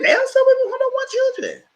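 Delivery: high prim mock voice. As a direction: toss it off in a put-on squeaky voice. Quoting her own reply.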